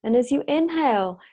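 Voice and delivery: unhurried meditation guide with long pauses; measured, spacious instructions, phrase by phrase; guided meditation. Real, not acted. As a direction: lead them gently into the breath.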